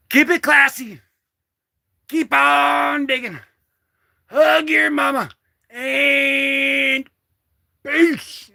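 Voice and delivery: hyped sign-off. Real, not acted. Signature sign-off catchphrase.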